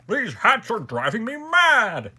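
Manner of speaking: exasperated high, loud shout; ringing royal outburst. A narrator's reading. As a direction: let the king's voice ring out, stretched and furious. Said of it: "The king's voice rang out".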